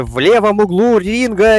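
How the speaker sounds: slow, drawn-out ring introduction; arena announcer. Pretend. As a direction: announce it like a boxing MC. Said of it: "вынуждает меня сказать что-то вроде".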